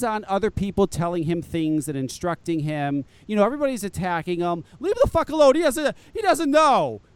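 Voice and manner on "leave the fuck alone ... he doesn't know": louder and higher, fed up with the attacks; exasperated outburst. Real, not acted. Defending Pete to the podcast crowd, a heated exasperated yell. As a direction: Leave the fuck alone, snapped out hard.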